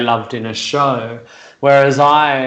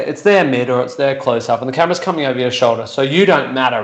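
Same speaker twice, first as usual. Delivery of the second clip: ordinary talk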